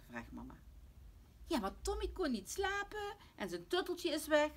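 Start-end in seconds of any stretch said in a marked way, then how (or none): 1.5-4.5 put-on high, loud childlike whine; protesting little girl. Lotje defends herself to mama, whiny child protest. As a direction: plead your case like a child.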